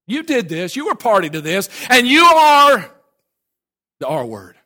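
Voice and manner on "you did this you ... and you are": building to a high, drawn-out shout; thundering accusation. Acted. Prophet confronts the king over the murder, building thundering accusation. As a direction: accuse him, swelling on the last words.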